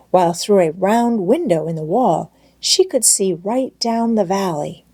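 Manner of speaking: usual speaking voice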